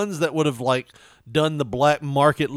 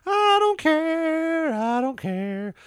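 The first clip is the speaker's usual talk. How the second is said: high, long drawn-out singsong; high-pitched mock refusal, vowels held long; imitating sound drop; 13 semitones higher, much slower than usual. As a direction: stretch it out slowly, mimicking a comedy clip. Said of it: "Opie used to have a long bit he would play".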